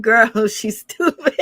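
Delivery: breaking up into laughter; drawn-out words dissolving into a real laugh. Real, not acted. Cracks up at the clip.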